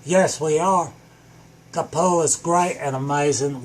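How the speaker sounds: ordinary delivery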